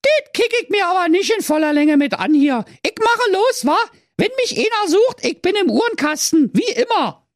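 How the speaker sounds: very high, loud cheeky voice; street-smart little goat; cheeky Berlin kid. A narrator's reading. Youngest kid leaves.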